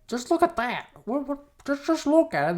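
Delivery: high-pitched, sputtering parent voice; mock parental outrage; shocked parent. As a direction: play a flustered parent in a high squeal. Parents reacting to Speedo handout.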